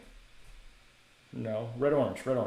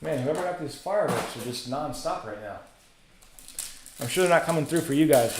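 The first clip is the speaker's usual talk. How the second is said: ordinary talk, nothing marked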